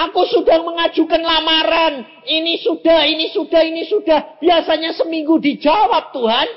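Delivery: high, loud, driving pulpit delivery; sustained preacher's shout, pressing and insistent; full-force preaching. Acted. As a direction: cry out an impatient believer's plea to God.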